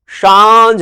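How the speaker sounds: सांझ with a long nasal आ; drawn-out "sããjh" for सांझ; nasal vowel demo. Shown as a demonstration. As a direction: stretch सांझ slowly through the nose. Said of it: "सांझ है".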